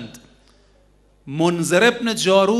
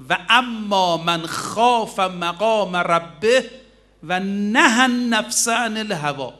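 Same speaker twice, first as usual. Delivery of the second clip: slow, drawn-out Arabic chant of a verse; slow measured recitation quoted in a sermon; Quranic recitation; 3 semitones higher, slower than usual. Acted. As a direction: intone the verse slowly and reverently.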